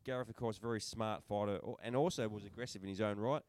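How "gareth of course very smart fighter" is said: normal talking voice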